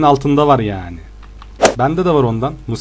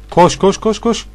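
urgent cheering; 6 semitones higher, slower than usual. Real, not acted. Cheering teammate on.

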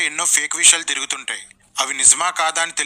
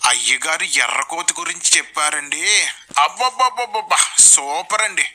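gushing devotee. Acted. Follower reporting on the preacher's sermon, mock gushing. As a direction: gush like an overimpressed follower, exclamations leaping up. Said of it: "మీ వాళ్ళు ఏం చెప్తారు?".

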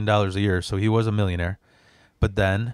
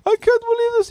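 mock crying; 3 dB louder than usual. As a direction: imitate mom breaking down in a squeaky sob. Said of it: "she just broke down crying".

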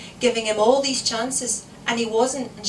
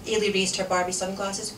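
usual speaking voice

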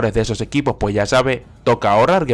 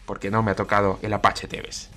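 ordinary delivery

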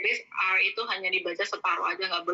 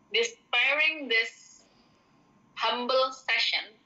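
slowly, a little higher; slow example; 3 semitones higher, much slower than usual. Shown as a demonstration. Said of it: "slow example sentence". (dibaca pelan sebagai contoh)

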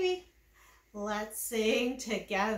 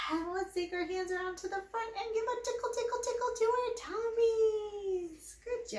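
playful tickle voice; 11 semitones higher than usual. Real, not acted.

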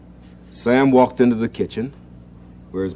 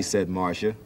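ordinary delivery, nothing marked

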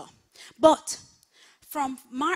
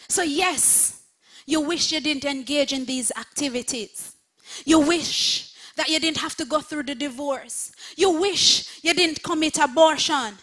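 louder preaching that builds on every "you wish"; full-force preaching; 4 semitones higher, 9 dB louder than usual. Acted. Pastor listing the congregation's regrets, building full-force preaching. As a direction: hammer each regret harder.

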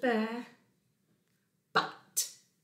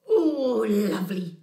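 loud drawn-out "oh" sinking into relief; blissful Bear; 6 dB louder, slower than usual. Acted. Bear finds a rock for scratching, blissful relief. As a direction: sigh it out with relish.

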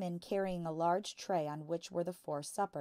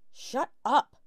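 angry shut up; 8 semitones higher, 12 dB louder than usual. A narrator's reading. Father silences Matilda.

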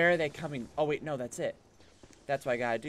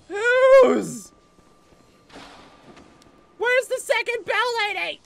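high, loud wailing protest; high-pitched screech of frustration; exasperated shouting; 13 dB louder than usual. Real, not acted. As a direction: yell in rising, drawn-out outrage. Overwhelmed by enemies.

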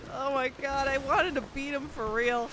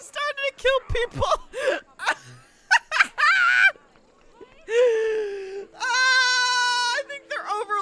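laughing; 9 semitones higher than usual. Real, not acted. Bobcats killing townsfolk.

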